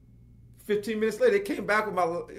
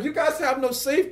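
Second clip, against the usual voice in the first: panicked; 13 semitones higher, 6 dB louder than usual. Retold or imitated. Panicking in the stuck trunk.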